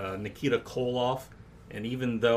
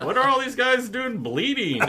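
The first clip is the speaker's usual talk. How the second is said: laughing; 8 dB louder than usual. Real, not acted. Laughing at wrestling magazine.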